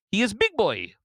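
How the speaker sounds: high-pitched mock Russian accent. Radio host mocking a governor as Big Boy, Russian accent. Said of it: "a Russian accent".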